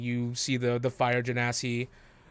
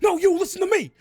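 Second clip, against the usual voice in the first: very high, loud shouted comeback; furious retort; 8 dB louder, faster than usual. Acted. Worker fires back at the boss in a heated shouting match. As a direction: shout them down hard. Heated kitchen argument voiced.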